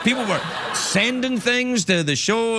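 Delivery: ordinary talk, nothing marked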